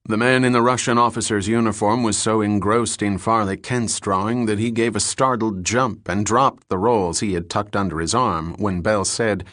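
usual speaking voice

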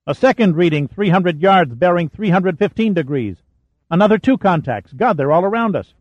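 rising thrill as contacts multiply; growing excitement. A narrator's reading. Console man announcing wrecks all around, excited.

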